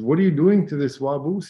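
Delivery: storyteller's mildly outraged intervention; indignant challenge. Retold or imitated. Nephew confronts man hitting the rabbit.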